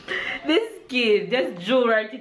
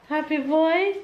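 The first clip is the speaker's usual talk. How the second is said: put-on baby talk; 6 semitones higher than usual. Cooing at baby.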